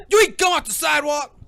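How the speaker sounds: high, loud acted dad yell; mock angry interrogation. Playing Will confronting his son, mock angry yell. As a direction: demand it like an outraged dad.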